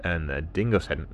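ordinary delivery, nothing marked

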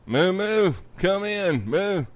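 high sing-out call to a lost cow; owner calling. Acted. Owner out in the woods calling the cow, high call. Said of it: "and the owner's out there".

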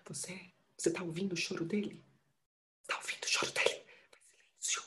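urgent hushed whisper. Acted. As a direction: whisper it, pleading for silence. Mother hushing, fearing baby's cry.

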